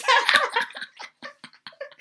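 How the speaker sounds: long high laugh. Real, not acted. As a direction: laugh hard at the joke. Laughing after hyping each other.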